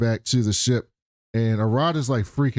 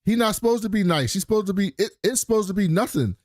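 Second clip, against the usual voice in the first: insistent objection; 8 semitones higher than usual. Retold or imitated. Acted objection to robot being nice.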